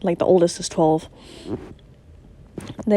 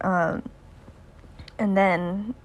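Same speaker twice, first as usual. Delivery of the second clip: usual speaking voice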